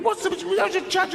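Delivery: very high, garbled mock protest; mimicked bickering. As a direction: babble the argument way up high.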